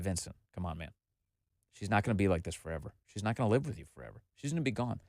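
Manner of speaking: soft, low, quick self-talk; internal monologue pep talk. Retold or imitated. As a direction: re-enact talking yourself down under your breath. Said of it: "internal monologue".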